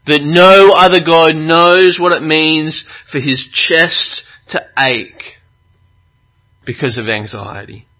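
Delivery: impassioned preaching. Acted. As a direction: press each phrase home from the pulpit. Preaches with full force.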